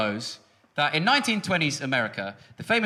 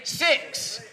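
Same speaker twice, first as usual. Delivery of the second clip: higher, louder shouted countdown; 6 semitones higher, 4 dB louder, much slower than usual. Real, not acted. Comic leading the crowd's New Year countdown, shouting each number. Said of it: "We're gonna count down from six".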